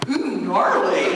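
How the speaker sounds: drawn-out amused ooh, half laughing; mock awed. Actor retelling a castmate's reaction, mock awed and slow. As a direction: savour it like a stoned surfer. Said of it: "there's this blonde furry hair going".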